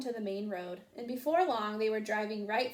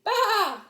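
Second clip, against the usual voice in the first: loud high sheep bleat; 11 semitones higher, 10 dB louder than usual. A narrator's reading. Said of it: "said Sheep".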